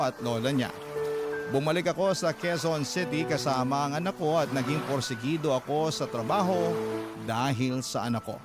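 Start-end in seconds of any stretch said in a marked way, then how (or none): none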